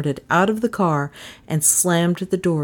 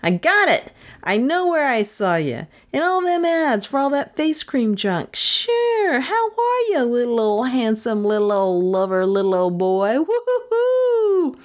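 high, gleeful crowing; jubilant, high-pitched razzing; joyous barfly; 8 semitones higher than usual. A narrator's reading. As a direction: tease him in a swooping high voice, ending in a whoop. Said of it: "Bert yelled joyously".

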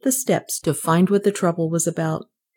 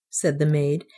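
usual speaking voice

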